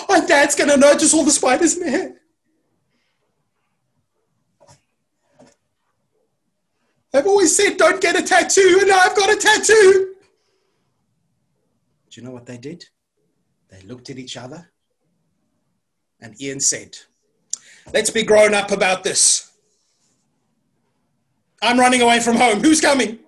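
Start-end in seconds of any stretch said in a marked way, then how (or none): 0.1-10.2 put-on loud, high-pitched kid's wail; panicking child, pitched way up; mock panic. Acting children panicking at the mess. 17.9-23.2 loud acted announcement; mock-brave declaration. Ian announces he is running away.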